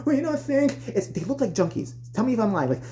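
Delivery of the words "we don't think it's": high, slow mock protest; complaining moms. Moms going down to confront the coach, high drawn-out protest. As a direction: drag it out, pitched up.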